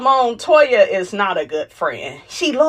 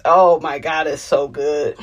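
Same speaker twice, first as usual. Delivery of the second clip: ordinary talk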